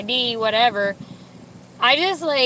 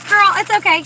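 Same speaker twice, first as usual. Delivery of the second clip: high, loud imitation of a sweet lady; imitating a kind cashier, higher and louder; 10 semitones higher, 9 dB louder than usual. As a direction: echo her friendly reassurance. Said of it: "She's like".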